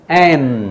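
"M" held into a hum through the nose; nasal M. Shown as a demonstration. Teacher models the letter's nasal sound, humming "M". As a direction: say "M" ending on closed lips. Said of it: "要发出鼻音".

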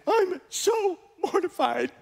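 high, drawn-out mock wail; hammed-up squeal of embarrassment; mortified wife. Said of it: "she's mortified".

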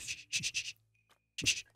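whispered hush; shushing. Real, not acted. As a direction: hush urgently. Hiding as he returns.

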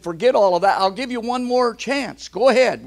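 high, loud genie offering again. Retold or imitated. Genie offers another chance.

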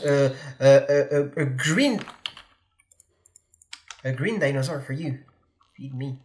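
low, hesitant servile voice; hunchback assistant. Pretend. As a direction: grovel like a lowly assistant. Servile creature voice offering food.

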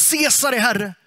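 mock imperial proclamation; acted acclamation. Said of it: "Konstant fick man ropa".

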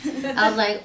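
usual speaking voice

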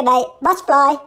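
ordinary delivery

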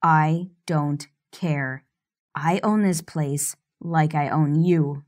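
cold. Acted. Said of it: "My voice comes out cold".

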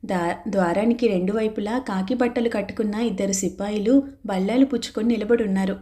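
ordinary delivery, nothing marked

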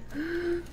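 a hurt character gasps high and sharp; high shocked gasp. Acted. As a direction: gasp as if deeply offended. Insulted character reacts.

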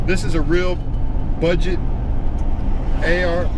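hiccuping between words; words broken up by hiccups. Real, not acted. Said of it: "Excuse me, I got the hiccups".

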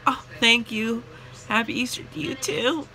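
fake politeness. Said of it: "I was like".

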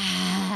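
loud, hammed-up pleasure moan; mock moan. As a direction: act out the moan, big and loud. Acting out moaning on beach.

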